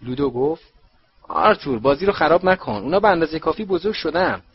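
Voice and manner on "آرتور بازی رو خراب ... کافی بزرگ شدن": louder, higher, brushing off the objection; loud, high-pitched objection in character; loud protest. A narrator's reading. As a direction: raise your voice to overrule a friend. Ludo jovially overrules Arthur's objection.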